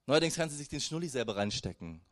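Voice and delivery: ordinary delivery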